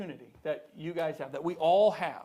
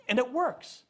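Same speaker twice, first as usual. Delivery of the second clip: ordinary talk, nothing marked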